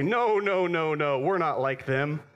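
loud, high insistent denial; mock protest. Preacher voicing a defensive listener, loud and high. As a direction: protest loudly that you are not like them. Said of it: "And you say".

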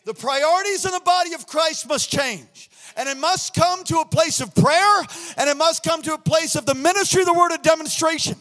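loud, shouting sermon; fiery pulpit shouting; preaching at full force. Acted. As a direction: preach it hard to the congregation.

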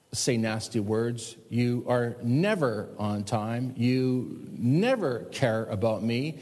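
slow, low nagging complaint, each always and never drawn out; a hint of a bitter spouse, dragging out the blame; mock accusing spouse. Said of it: "never use words like this".

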